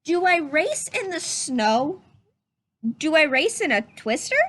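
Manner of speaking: lively, curious rising questions; questioning read-aloud voice. Shown as a demonstration. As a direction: read each question like a wondering child. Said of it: "notice how I change my voice when I read a question".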